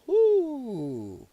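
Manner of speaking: high whoo sliding down; astonished whoo. Real, not acted. Host reacting to a picture from chat, astonished whoo. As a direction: let out a long, falling whoo.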